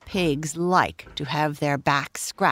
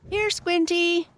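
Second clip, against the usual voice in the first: calling out; 12 semitones higher than usual. Acted. Farmer's wife calls Squinty over to eat, pitched high. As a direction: call the pig over gently. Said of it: "called the farmer's wife".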